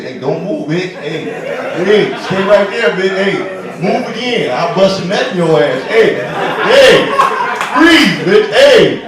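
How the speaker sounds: loud hammed-up cop yelling orders; mock cop. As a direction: bark like a cartoon cop making an arrest. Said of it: "you want me to be the cop".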